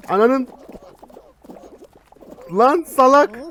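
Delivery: furious shout at other players; angry yelling. Real, not acted. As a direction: yell the insult loud and high.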